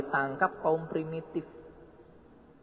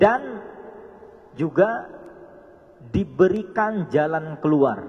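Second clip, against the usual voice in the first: forceful preaching; 3 semitones higher, 8 dB louder, slower than usual. Acted. Preacher promising a way out, loud and fervent. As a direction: drive the promise home loudly.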